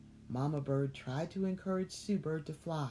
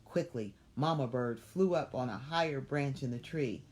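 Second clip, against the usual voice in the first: plain reading voice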